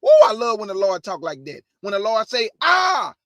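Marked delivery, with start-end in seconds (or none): 2.6-3.1 high, loud burst on I; shouted I. Acted. Preacher proclaiming God's words, shouting I. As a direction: blast the word I out.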